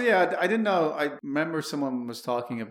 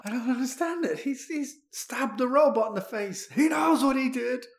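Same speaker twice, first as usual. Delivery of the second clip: very high-pitched put-on voice; mock bewildered protest; 11 semitones higher than usual. Friend after the Vegas robot stabbing, mock bewildered protest.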